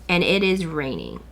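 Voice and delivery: normal talking voice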